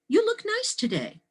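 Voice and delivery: high-pitched imitation of his compliment; middle-aged male colleague. Colleague complimenting the teacher between classes, imitated high-pitched. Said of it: "he says to me".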